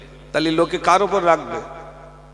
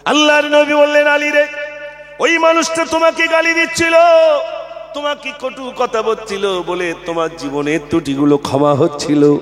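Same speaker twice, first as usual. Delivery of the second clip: impassioned waz narration; full-force preaching; 6 dB louder than usual. Acted. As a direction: preach the tale in a soaring chant.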